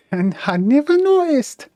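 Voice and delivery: giggling copy of the character's line; laughing mock Geordie. Player repeats line laughing, higher.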